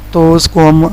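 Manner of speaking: usual speaking voice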